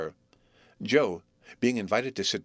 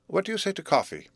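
Herbert's voice; 9 semitones higher than usual. A narrator's reading. Herbert offers coffee.

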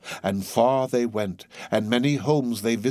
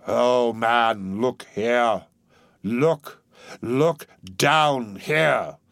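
loud, drawn-out ghostly cry; exclaiming ghost; 4 dB louder, much slower than usual. A narrator's reading. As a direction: urge him to look down. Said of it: "exclaimed the ghost".

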